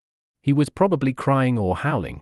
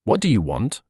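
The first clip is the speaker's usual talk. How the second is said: nasty. A narrator's reading. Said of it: "Courage spat rather nastily".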